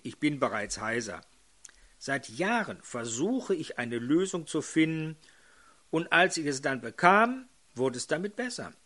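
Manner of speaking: ordinary delivery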